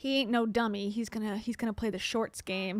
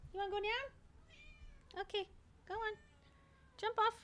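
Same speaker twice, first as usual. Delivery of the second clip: much higher, much softer coaxing to a pet; high soft pet talk; 10 semitones higher, 15 dB quieter than usual. Real, not acted. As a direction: coax the pet softly in a high voice. Talking to a pet off-mic.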